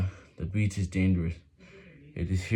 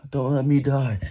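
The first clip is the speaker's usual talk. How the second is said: desperate plea; 5 semitones higher, 5 dB louder than usual. Acted. Refugee pleading on sinking boat.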